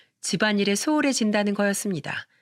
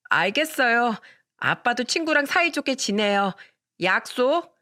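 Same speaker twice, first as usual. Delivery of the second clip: put-on child voice; 4 semitones higher than usual. Child voice promising father.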